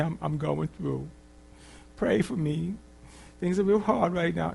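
mock-pitiful plea, high and soft; whining. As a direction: imitate a self-pitying whiner. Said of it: "cry and whine and act like a little sissy".

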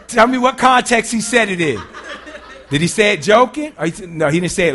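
loud, high-pitched mock interrogation; acted member pressing loudly for details; animated questioning. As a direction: demand the context, playing it up for laughs. Acting out member questioning the rumor.

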